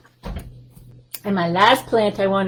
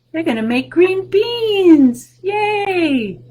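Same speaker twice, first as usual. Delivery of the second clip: higher, louder, delighted yay; excited cheer; 11 semitones higher, 6 dB louder, much slower than usual. Shown as a demonstration. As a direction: cheer it for the kids. Excited for children.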